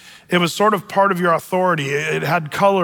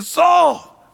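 shouted warning; 9 semitones higher, slower than usual. Retold or imitated. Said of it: "And David said".

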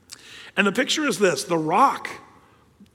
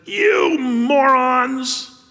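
loud, slow, exasperated "You morons"; slow angry bellow; angry Moses; 6 semitones higher, 5 dB louder, much slower than usual. Acted. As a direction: spit out the insult as a fed-up leader. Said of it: "He calls them a bunch of morons".

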